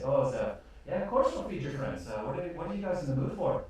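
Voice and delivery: sweet and eager to serve; cheery barmaids. Acted. Barmaids offering to feed the newcomers, high and bubbly.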